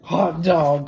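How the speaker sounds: put-on high, loud gleeful kids' shout; excited cry. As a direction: cheer like a class of freed kids. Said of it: "cried the kids".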